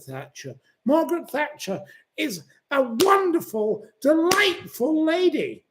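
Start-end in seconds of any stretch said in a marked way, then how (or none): none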